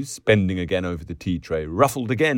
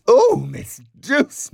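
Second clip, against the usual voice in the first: high, loud, drawn-out reproach; girlish, scandalised exclamation; scandalised protest; 7 dB louder, slower than usual. A narrator's reading. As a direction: protest slowly in mock shock. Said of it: "Miss Kennedy protested".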